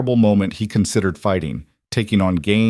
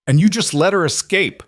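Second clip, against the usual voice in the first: angry retort; 10 semitones higher, 3 dB louder than usual. A narrator's reading. Webb angry at Cole.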